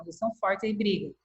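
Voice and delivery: ordinary talk, nothing marked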